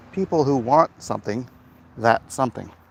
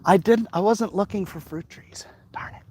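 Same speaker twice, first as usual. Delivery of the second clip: whispered reply; 6 semitones higher than usual. Real, not acted. Answering a chat joke while walking.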